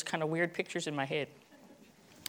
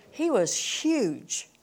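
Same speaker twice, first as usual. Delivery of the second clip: drawn-out huge; 5 semitones higher, much slower than usual. Shown as a demonstration. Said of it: "dragged-out word".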